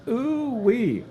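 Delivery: high, delighted whoop; excited ooh-wee. Real, not acted. Host cheering a box of beef patties, delighted high whoop.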